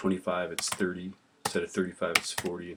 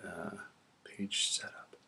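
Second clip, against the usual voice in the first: muttered whisper; 9 dB quieter, slower than usual. Real, not acted. Teacher mutters while hunting menus.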